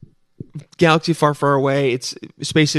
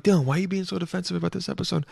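higher, softer and fast, a listener teasing; mocking listener voice; 4 semitones higher, 6 dB quieter, faster than usual. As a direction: imitate a listener nagging Dylan. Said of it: "And you're like".

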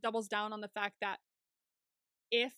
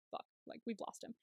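mock whisper of defeat; whispered curse; 11 dB quieter than usual. Cops muttering after losing the kid, whispered. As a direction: breathe the swear under your breath.

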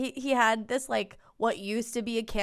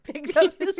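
usual speaking voice

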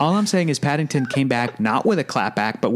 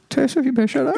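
squeaky high mock warning; high laughing threat; 12 semitones higher, faster than usual. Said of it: "I said".

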